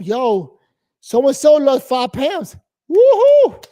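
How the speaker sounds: building to a high, loud whoop; slow fake congratulation bursting into a whoop; mock office cheer. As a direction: hype it up like a cheering coworker. Mocking office cheer for weight loss.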